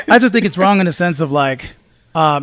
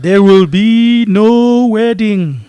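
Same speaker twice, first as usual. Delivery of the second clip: slow, drawn-out, solemn pronouncement; dramatic movie line; 3 semitones higher, 5 dB louder, much slower than usual. Retold or imitated. Quoting the movie Sinbad to friends, slow and grand. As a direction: declare it like a film villain. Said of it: "just like in the movie Sinbad".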